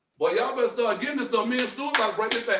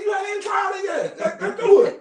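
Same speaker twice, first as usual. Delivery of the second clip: laughing insistence; 9 semitones higher, 5 dB louder than usual. Retold or imitated. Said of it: "I said".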